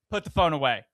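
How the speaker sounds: loud mock military order. Teasing partner about scolding a moviegoer, imitated military voice. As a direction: imitate a firm, commanding military voice. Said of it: "This is my military voice".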